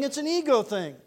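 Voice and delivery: ordinary delivery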